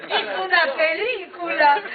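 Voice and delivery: put-on laughing kid quote. Son reassuring his mother, laughing. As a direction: say it while cracking up. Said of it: "Y me dijo".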